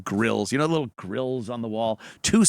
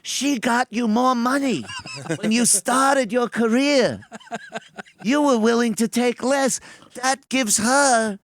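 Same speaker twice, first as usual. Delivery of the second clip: high, slow lawyer voice; mock divorce lawyer; 11 semitones higher than usual. Mocking the divorce lawyer pleading against him. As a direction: argue it like a smug attorney.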